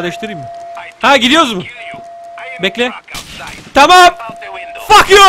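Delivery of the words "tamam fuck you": high shouted retort; loud defiance. Pretend. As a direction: yell it at the enemy. Reacting in game action. (meydan okurcasına bağırarak)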